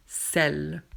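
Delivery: sel with open è, drawn out; grave vowel. Shown as a demonstration. Teacher answers the exercise, open è in sel. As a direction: say sel slowly as "sèl". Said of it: "c'est grave ou circonflexe".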